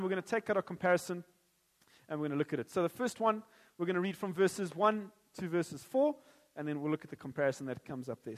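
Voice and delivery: ordinary talk, nothing marked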